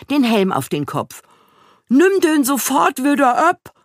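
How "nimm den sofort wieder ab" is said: high, loud, indignant order; angry knight's cry. A narrator's reading. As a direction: call it out furiously as an outraged knight. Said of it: "rief Ritter Heribert wütend". (wütender Ausruf eines Ritters)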